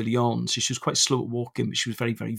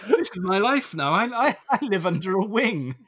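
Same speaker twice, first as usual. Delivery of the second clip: laughing resigned; 8 semitones higher than usual. Retold or imitated.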